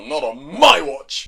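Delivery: loud, drawn-out mock objection; forbidding. Witch forbids boy-girl sleepover, forbidding. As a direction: stretch it out emphatically, putting a foot down.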